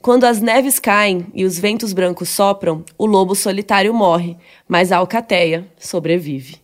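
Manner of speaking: slow, measured recitation; solemn slogan quote. Acted. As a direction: recite it like a weighty saying, slowing towards the end. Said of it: "ele vai falar uma frase de caneca".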